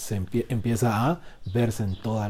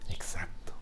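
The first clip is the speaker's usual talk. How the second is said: whispered; 5 semitones lower, 13 dB quieter, slower than usual. Real, not acted. Whispered aside.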